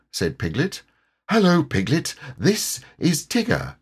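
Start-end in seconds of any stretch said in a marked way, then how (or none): 1.3-3.8 higher-pitched character greeting; lightly voiced friendly bear; storybook bear introduction. A narrator's reading. Pooh introduces Tigger.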